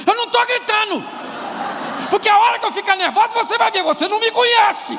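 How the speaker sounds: high-pitched, loud, rapid outburst; nervous shouting wife. Acted. Angry wife denies to her husband that she's shouting, yelled. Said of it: "ela sabe que tá gritando".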